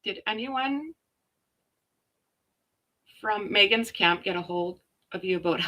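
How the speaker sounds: ordinary talk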